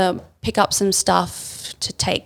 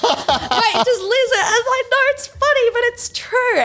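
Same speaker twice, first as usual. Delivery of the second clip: much higher, squeaky with laughter; laughing protest. Real, not acted. Storyteller insisting to a doubting friend, laughing protest. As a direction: plead through real giggles. Laughs while pleading to be heard.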